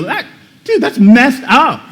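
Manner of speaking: high, laughing "that's messed up"; half-laugh it out in shock; laughing disbelief. Retold or imitated. Preacher showing how the listener should react, laughing. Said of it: "you're supposed to see that and go".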